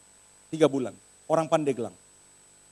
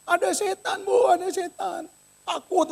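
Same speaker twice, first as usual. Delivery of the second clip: high, whimpering fright; mock tearful fear; 13 semitones higher than usual. Maid meeting her employer at home, tearful fright. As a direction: imitate a frightened, weeping maid. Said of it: "Dia nangis-nangis dia keluar".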